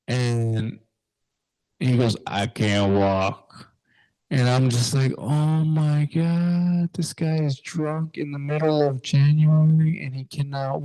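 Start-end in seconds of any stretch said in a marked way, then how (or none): 2.3-3.7 slow, drawn-out drunk imitation; drunk man. Drunk stranger refusing to walk in winter, slow helpless drawl. 5.2-10.8 higher-pitched retold dismay; exasperated. Teen realizing the drunk stranger cannot walk, exasperated.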